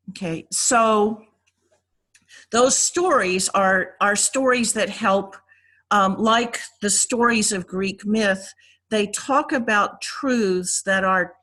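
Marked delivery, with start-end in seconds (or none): none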